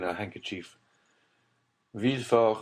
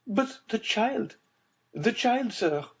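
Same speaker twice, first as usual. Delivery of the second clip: much higher, pleading mother's voice; agitated mother; slower than usual. A narrator's reading. Mother begging Villefort for news of her child, agitated. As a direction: press him, desperate.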